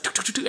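quick spoken footstep taps; imitated footsteps. Storyteller mimics feet coming down subway steps, imitated footsteps. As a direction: tap out feet on the stairs with your voice. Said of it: "feet coming down the subway platform steps".

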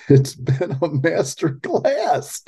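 slow, laughing through the quote; mock the boast with a laugh; laughing mockery.

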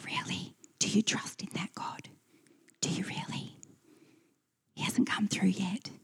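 sly doubting whisper in the ear; hushed devil planting doubt. Acted. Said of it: "whisper in our ear".

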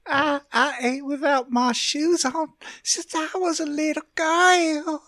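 put-on high, slow little-girl voice; childish voice. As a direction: play a regressed grown woman. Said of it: "She goes full child".

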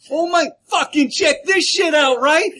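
loud, high, hammed-up Jersey accent. Jersey newscaster telling of the risen dead, shouted Jersey accent. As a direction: holler it like a mock Jersey local. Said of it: "I thought that was Jersey".

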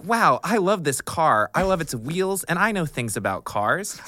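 hammed-up enthusiasm, high and loud; mock script read. Actress faking car knowledge at audition, high mock gushing. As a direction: gush like a bad car-show pitch.

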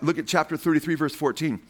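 usual speaking voice

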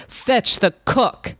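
an angry king calling for his cook, spelled out slowly; king's thunderous order. Acted. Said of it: "in a voice like thunder, he shouted".

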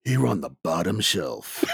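booming movie-trailer announcer; movie guy voice. Acted. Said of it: "decided to go with my movie guy voice".

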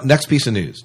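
ordinary talk, nothing marked

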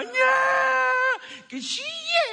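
hammed-up baby cry for the bottle. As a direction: wail like a bawling infant.

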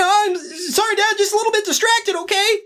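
put-on high, fast, apologetic kid voice; flustered son. Pep excusing himself to his dad, high fast kid voice. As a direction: brush dad off, quick and defensive. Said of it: "You're like".

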